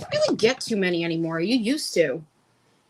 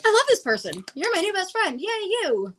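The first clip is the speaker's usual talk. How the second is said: gushing; 12 semitones higher than usual. Retold or imitated. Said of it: "me and Stacy are like".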